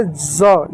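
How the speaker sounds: "ज" with a drawn-out buzzing z; z sound. Shown as a demonstration. Teacher explaining S read as Z, "ज" as za. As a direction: buzz into "ज" like English Z. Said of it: "एस को जेड के जैसा हमने पढ़ा".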